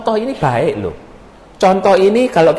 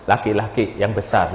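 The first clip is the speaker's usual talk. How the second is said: usual speaking voice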